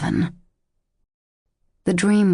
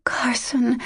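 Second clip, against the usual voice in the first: tortured whisper; 7 semitones higher than usual. A narrator's reading. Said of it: "she whispered in a tortured voice".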